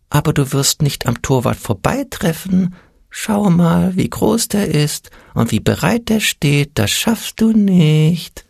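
high, needling little voice, stretched at the end; thin little voice. A narrator's reading. Little voice discourages Ole before his shot, thin and high. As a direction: talk him out of it in a tiny high voice. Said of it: "ein dünnes Stimmchen".

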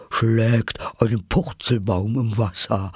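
animated creature cry; excited calling out. A narrator's reading. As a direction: call it out with wild glee, held back. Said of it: "rief die falsche Schildkröte".

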